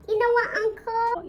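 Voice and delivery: cousin's voice. Retold or imitated. Cousin answers the angry uncle.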